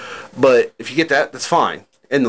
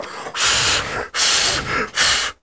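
hard puffs into the mic; forceful breath bursts; blowing noise; 4 dB quieter than usual. Shown as a demonstration. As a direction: blow sharply and repeatedly into the microphone.

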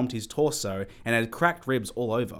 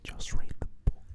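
whisper. Real, not acted. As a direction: hiss it under your breath. Whispered aside.

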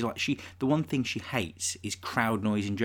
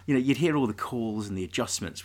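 ordinary talk, nothing marked

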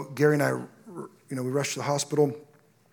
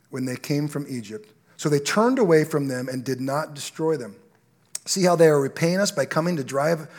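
louder, earnest scripture read; 5 dB louder than usual. Acted. Pastor reading the king's prayer to the church.

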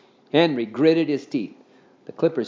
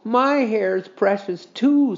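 plaintive; 9 semitones higher, 4 dB louder, much slower than usual. A narrator's reading. Henry's slow plea.

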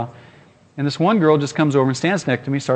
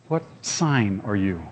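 lower, slow, softer earnest question; mock serious girl; 6 semitones lower, 7 dB quieter, slower than usual. Mimicking the girl's solemn question, slow and low. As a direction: drag it out with fake gravity.

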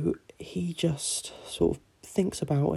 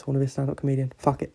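ordinary talk

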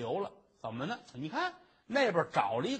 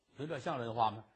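ordinary delivery

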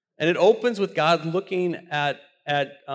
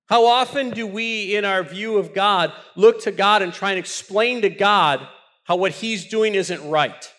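full-force preaching; 5 semitones higher, 6 dB louder than usual. Acted.

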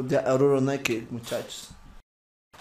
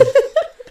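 risita aguda y corta; risa aguda; 5 dB louder than usual. Real, not acted. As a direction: suelta una risa aguda. Risa tras broma. (risita aguda)

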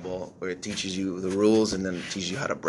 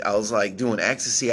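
ordinary talk, nothing marked

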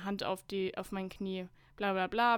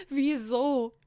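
loud, higher, hammed-up whine; tearful wail; 4 semitones higher, 7 dB louder than usual. A narrator's reading. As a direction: wail it like a melodramatic heartbreak. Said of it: "jammere ich weinerlich". (weinerlich gejammert)

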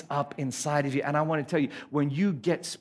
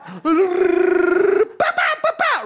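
loud, high trilled babble; mock possession noise; 12 semitones higher, 7 dB louder than usual. Preacher mimicking a ghost taking over, mock possession noise. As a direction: shriek and splutter like a hammed-up possession. Acts ghost entering a body.

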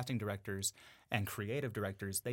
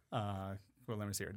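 ordinary delivery

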